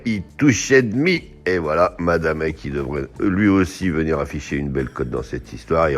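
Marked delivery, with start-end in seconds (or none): 0.0-1.3 drawn-out, high English-accent horse name; mock-perfect English accent. Shown as a demonstration. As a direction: show off a playful English accent, slowly. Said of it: "avec mon accent anglais parfait".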